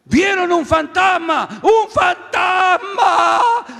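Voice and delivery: drawn-out frightened cry, high and loud; dragged-out scared shriek; scream of fear. Shown as a demonstration. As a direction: act out the disciples' terror at a ghost. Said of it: "vamos a hacer un grito de miedo".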